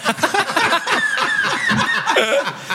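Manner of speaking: bursts out laughing high; cracks up at his own story; a real high-pitched laugh. Real, not acted.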